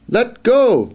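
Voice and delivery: high, loud mouse cry telling the other to let go; urgent cry. Acted. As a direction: shout it out in a squeaky panic.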